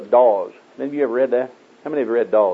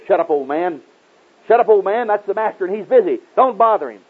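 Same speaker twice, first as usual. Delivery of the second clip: raised, higher scolding voice; mock scolding, pitched up; fussing at him; 8 semitones higher, 3 dB louder than usual. The crowd hushing the old blind beggar, fussing. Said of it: "to fuss at him and say".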